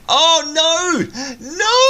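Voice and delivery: high, loud cry of dismay on both noes; shocked cry. Real, not acted. Host reacting to a trade of pink dye, shocked loud squeal. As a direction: squeal out the no as the gift lands.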